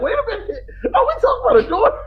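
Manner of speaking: high, fast, stunned question; shocked disbelief. Retold or imitated. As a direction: shoot up in total disbelief. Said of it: "I'm like".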